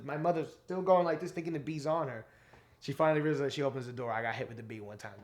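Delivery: usual speaking voice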